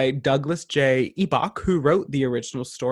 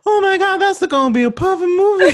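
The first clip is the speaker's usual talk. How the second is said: laughing shriek; 9 dB louder than usual. Real, not acted. Reacting to friend's funny admission.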